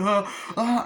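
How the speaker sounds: high acted-out yelp; mock scream. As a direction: gasp out a high pretend cry. Imitates film victim being pulled.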